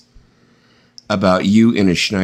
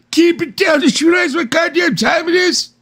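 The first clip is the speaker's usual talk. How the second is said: put-on grumpy old man shout. Old man yelling at kids across the street, shouting. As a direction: holler like a cranky old neighbour. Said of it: "that old man who's like".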